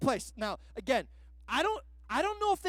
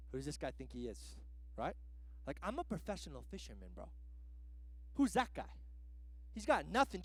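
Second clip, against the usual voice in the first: low, soft, indignant grumble; annoyed Peter; 8 semitones lower, 11 dB quieter than usual. Retold or imitated. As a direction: grumble like an insulted pro fisherman. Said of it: "I picture Peter on the boat".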